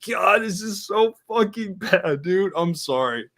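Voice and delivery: slow disgusted complaint; exasperated groan. Retold or imitated. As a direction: whine it like it pains you. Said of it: "I'm just like".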